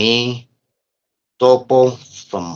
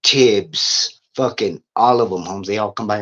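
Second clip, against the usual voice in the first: ordinary talk, nothing marked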